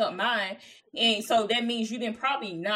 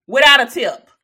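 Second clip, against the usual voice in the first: much louder, punching the point; emphatic; 3 semitones higher, 17 dB louder than usual. Real, not acted. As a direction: hammer it home. Friend stresses point loudly.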